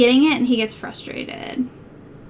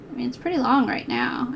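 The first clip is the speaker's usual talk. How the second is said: normal talking voice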